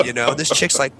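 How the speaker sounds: ordinary talk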